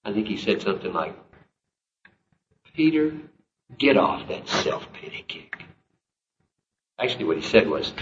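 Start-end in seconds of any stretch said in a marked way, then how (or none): 2.7-5.6 rebuking. Retold or imitated. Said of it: "what tone of voice I think he had".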